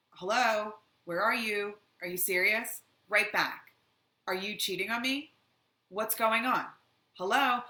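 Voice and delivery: a jealous partner's rising anger; angry jealous texts. Retold or imitated. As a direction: hammer them with jealous questions. Acting out angry spamming texts.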